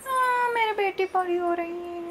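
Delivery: high, soft doting coo. Real, not acted. Mother to her little daughter who is growing up, cooing baby talk. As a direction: coo softly in a high, sustained voice.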